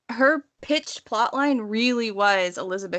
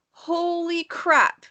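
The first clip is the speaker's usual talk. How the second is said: drawn-out, much higher holy crap; amazed exclaim; 9 semitones higher, much slower than usual. Real, not acted. Telling the co-host how striking the old clips were, in slow, high amazement. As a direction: let the awe spill out slowly.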